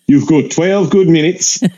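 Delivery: mock Aussie breaking into laughs; blunt Aussie verdict through laughter; Australian accent. Australian passenger rating the act, laughing mock Australian accent. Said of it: "I apologize to my Australian listeners".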